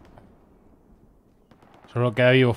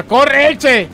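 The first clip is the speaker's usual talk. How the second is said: high, loud call to run; urgent shout; 12 semitones higher, 6 dB louder than usual. Real, not acted. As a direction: yell it at him to get moving. Urging a soldier to run.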